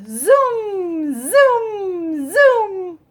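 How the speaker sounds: high, loud zoom zoom; zooming sound. Acted. Child racing around the store, loud high zooms. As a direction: swoosh it out like a racing chair.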